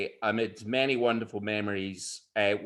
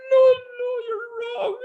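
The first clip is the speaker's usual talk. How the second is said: high crying cry of denial, louder on the no; tearful protest; 6 dB louder than usual. Retold or imitated. Hodja protesting to his friends through mock tears, high. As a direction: cry it out, much higher, refusing the blame. Hodja weeping over dead cat.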